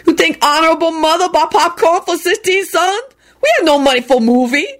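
put-on high, thickly accented old man. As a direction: play the broke old father, accent on. Old man speaks, heavy accent.

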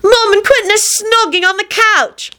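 high-pitched tattling call; yelling across. A narrator's reading. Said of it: "could not resist yelling across".

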